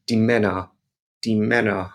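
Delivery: "Männer" with a short, clipped "ä" like English "men"; short German E. Shown as a demonstration. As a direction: hit the "ä" in "Männer" only briefly. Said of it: "And it's very short".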